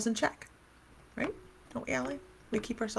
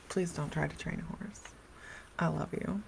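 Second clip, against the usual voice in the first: soft plea; 13 dB quieter than usual. Retold or imitated. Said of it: "she's like".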